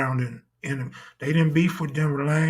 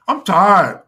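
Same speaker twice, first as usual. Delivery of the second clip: louder whiny complaint; 2 semitones higher, 6 dB louder than usual. Retold or imitated. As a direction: whine it louder, pleading. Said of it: "Tell me".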